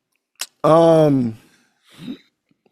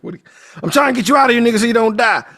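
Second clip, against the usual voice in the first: rushed, louder exasperated plea; hurried exasperated Tibbs; 7 semitones higher, 4 dB louder, much faster than usual. Retold or imitated. Tibbs urgently pleads with the sheriff, rushed and exasperated. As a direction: rattle it off fast to save him. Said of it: "It's like".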